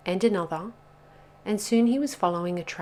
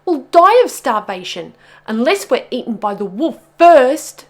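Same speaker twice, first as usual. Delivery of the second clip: high, loud, doom-laden wailing; despairing wail; 7 semitones higher, 16 dB louder than usual. A narrator's reading. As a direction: wail as if all is doomed. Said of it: "wailed Pog".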